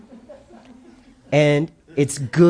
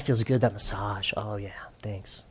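contented murmur; 5 semitones lower, 12 dB quieter than usual. Acted. Husband enjoys a coworker's massage.

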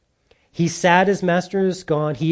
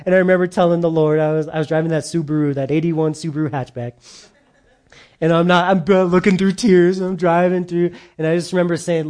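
normal talking voice

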